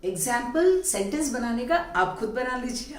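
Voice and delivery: normal talking voice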